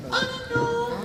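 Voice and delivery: high-pitched mock tearful wail; hammed-up tearful squeak; imitated crying. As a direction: whimper it like a crying girl. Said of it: "this girl started crying".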